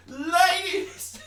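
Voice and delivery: a failed Bill Burr style ladies call; botched Bill Burr. Acted. Botched comic impression calling out to women listeners. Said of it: "I did it wrong".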